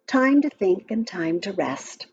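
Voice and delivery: usual speaking voice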